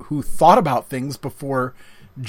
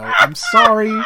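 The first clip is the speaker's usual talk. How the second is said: laughing; 9 dB louder than usual. Real, not acted. Laughing apology after joke.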